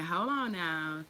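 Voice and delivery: ordinary talk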